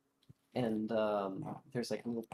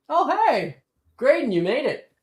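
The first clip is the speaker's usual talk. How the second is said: high, loud welcome; loud, delighted hello; excited greeting; 15 dB louder than usual. Acted. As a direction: call out gladly to an arriving friend. Greets arriving friend across beach.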